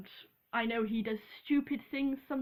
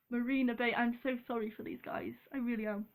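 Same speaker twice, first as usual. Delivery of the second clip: ordinary delivery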